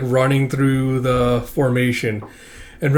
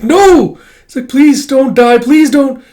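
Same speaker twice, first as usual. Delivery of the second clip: high, loud desperate cry; frantic pleading; 12 semitones higher, 9 dB louder, slower than usual. Acted. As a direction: beg her not to die. Kippa sees arm wither.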